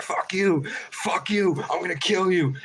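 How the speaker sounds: shrill-pitched mock fury, fuck you fuck you; yelling up at the crow, furious and pitched high; high angry yell. Yelling at the crow from his tent, high and angry. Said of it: "And I'm like".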